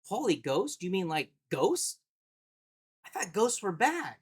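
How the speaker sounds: put-on light little-girl voice, dead serious; imitating his daughter. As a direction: play a confused five-year-old questioning dad. Daughter quoted in a story.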